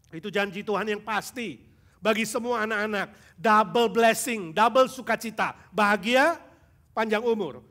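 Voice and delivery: fervent preacher pressing the congregation; preaching at full force. Acted. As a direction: preach it hard to the youth. Preaching a promise.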